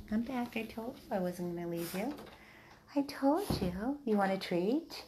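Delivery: high cooing reassurance to the dogs; sing-song pet voice. Real, not acted.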